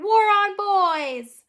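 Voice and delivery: slow, high, loud chant; drawn-out rallying shout; shouted war cry. A narrator's reading. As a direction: yell it as a battle slogan. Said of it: "the girls shouted".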